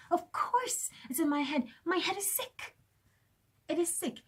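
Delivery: breathy high whisper, pointed; whispered, intense aside. Real, not acted. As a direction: hush it as a bitter aside.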